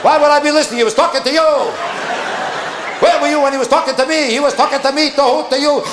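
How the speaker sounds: squabble at full pitch; mock marital fight. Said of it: "the same fight".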